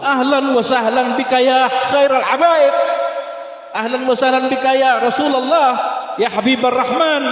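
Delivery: high, loud, slow ecstatic greeting; joyful welcome shout. Acted. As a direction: call out the welcome with overflowing joy. Host joyfully welcomes the Prophet.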